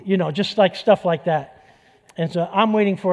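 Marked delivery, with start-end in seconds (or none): none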